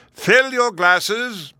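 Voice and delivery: high, drawn-out ceremonial shout; thundering command. A narrator's reading. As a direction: call it out slowly to a whole hall. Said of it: "he thundered".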